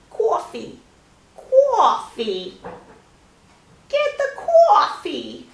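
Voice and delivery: loud, drawn-out American accent on "coffee". Shown as a demonstration. Showing the only words they can do, exaggerated American accent. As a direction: belt it out in a put-on American accent. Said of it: "I can only do, like".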